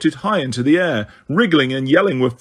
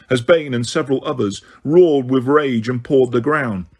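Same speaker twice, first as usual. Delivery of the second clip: usual speaking voice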